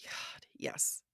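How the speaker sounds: soft breathy whisper. Real, not acted. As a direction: drop to a whisper. Agreeing aside.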